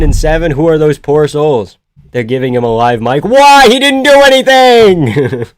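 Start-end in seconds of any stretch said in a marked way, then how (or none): 3.2-5.5 high, loud yell of disbelief; loud, stretched, exasperated holler; incredulous shout. Real, not acted. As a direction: shout in protest, dragging out the words. Protests fighter getting a live mic.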